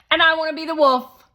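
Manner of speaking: put-on high, loud pushy boy; Shake voice. A narrator's reading. Narrator voicing Shake, a loud, high pushy boy. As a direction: play the bossy guest kid, loud and high.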